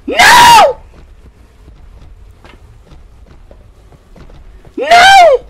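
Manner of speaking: very high, loud shriek; piercing cry of panic; panicked scream. Real, not acted. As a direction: scream in refusal. Pursuer close behind in game.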